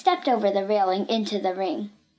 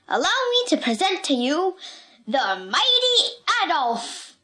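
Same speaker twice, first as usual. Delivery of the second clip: circus ringmaster; 2 semitones higher than usual. Acted. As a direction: announce the star act with swelling pomp.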